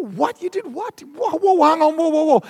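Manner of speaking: high, startled whoas, cutting in; stunned disbelief. Retold or imitated. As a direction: stop them mid-sentence in disbelief.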